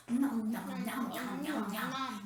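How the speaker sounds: soft, low munching nom noms; eating noises. Shown as a demonstration. Presenter showing the kids an eating sound, soft munching. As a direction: chomp on the syllables like a hungry kid. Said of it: "the sounds of eating, kinda like this".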